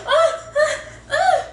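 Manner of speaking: high, loud anguished ahs; mock anguished wail. Showing how the actress should have reacted to the smashed glass, with a hammed-up high wail. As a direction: ham up a wailing breakdown. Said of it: "I'd be like".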